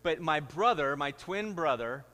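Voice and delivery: ordinary delivery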